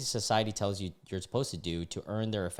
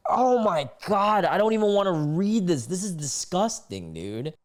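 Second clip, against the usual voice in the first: disgusted outburst; 8 semitones higher, 6 dB louder than usual. Real, not acted. Reacting to a vile post.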